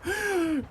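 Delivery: sharp high gasp; startled gasp. Real, not acted. Gamer startled mid-match, high shocked gasp. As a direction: suck in a shocked breath.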